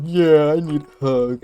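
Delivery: high, loud mocking whine of a crying guy; mock sob voice begging for a hug; whiny crying mock. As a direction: mimic him begging for a hug, hammed up. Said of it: "So he's like".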